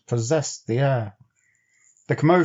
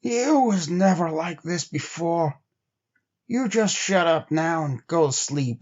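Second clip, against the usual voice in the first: weary trapper Henry; 4 semitones higher than usual. A narrator's reading. Henry telling Bill to shut up and sleep, drowsy higher voice. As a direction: soothe a fretful partner while half asleep.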